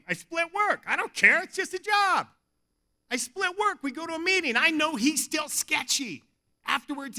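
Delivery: ordinary talk, nothing marked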